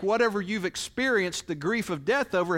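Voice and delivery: usual speaking voice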